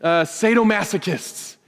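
preacher mocking a misreading of Peter with fake pleasure; mock sadomasochist. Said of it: "as if Peter was saying".

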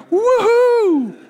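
high, loud whoop; gleeful yelp from the dogs; excited dogs cheering. Pretend. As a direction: cheer like happy dogs getting scraps. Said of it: "the dogs are like".

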